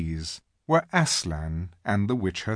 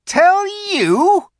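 shrill, incredulous witch shriek; suddenly shriller; 11 dB louder than usual. A narrator's reading. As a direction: throw it back high and loud. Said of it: "her voice growing suddenly shriller".

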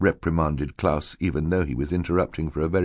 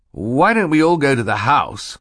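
high, loud suggestion; officious banker; 8 semitones higher, 5 dB louder than usual. Acted. Mister Poe counters Stefano's plan, high and loud. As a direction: propose the sensible plan brightly.

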